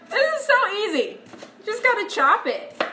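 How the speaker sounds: high-pitched, upbeat insistence; high, chirpy encouragement; insisting it's easy. Real, not acted. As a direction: sing out how easy it is, pitched well up. Chopping while insisting it is easy.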